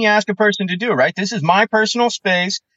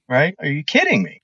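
ordinary delivery

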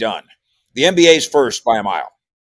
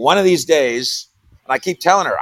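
ordinary talk

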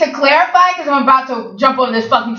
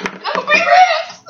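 squealing high shout; 14 semitones higher, slower than usual. Real, not acted. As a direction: shriek it out, slow and high. Mock outrage at photo.

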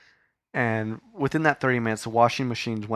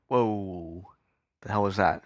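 startled; slower than usual. Real, not acted. Startled by sudden flash of light.

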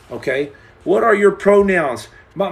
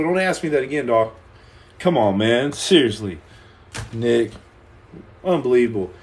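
masculine; 8 semitones lower than usual. Shown as a demonstration. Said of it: "The most masculine way possible".

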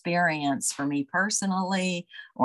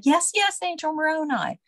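much higher and louder, eager and thrilled; joyful recognition; 11 semitones higher, 4 dB louder than usual. Retold or imitated. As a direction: gasp it out in happy recognition. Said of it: "And she said".

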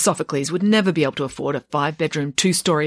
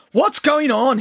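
higher, louder angry demand; 9 semitones higher, 4 dB louder, slower than usual. A narrator's reading. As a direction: burst in and demand an answer. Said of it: "he demanded".

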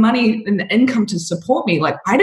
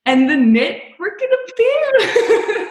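high, slow, laughing through it; 14 semitones higher, slower than usual. Real, not acted. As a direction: laugh out the happy ending. Joyful punchline, laughing.

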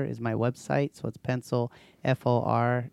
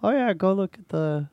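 casual boast; 6 semitones higher, 4 dB louder than usual. Retold or imitated. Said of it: "I will tell people".

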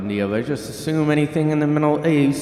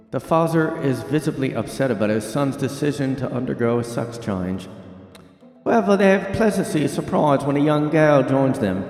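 hammed-up British news-reading accent; British newsreader; 2 semitones higher than usual. Host reads Starbucks ad plot, mock British newsreader accent. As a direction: play a mock British anchor telling a heartwarming story. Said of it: "I'm having trouble with the accent".

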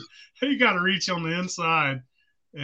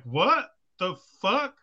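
slow, drawn-out baffled question, pitched up; retold bewilderment; 4 semitones higher, much slower than usual. Retelling the surprise voice in the car, drawn-out bewilderment. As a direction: stretch each word in mock bafflement.

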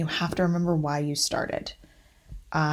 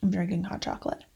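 usual speaking voice

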